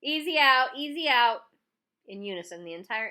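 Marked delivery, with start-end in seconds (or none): 0.0-1.4 loud taunting holler across the field. A narrator's reading. Pitcher hollering a taunt to his team, loud. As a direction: holler it to the whole team, mocking. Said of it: "hollered to the rest of his team".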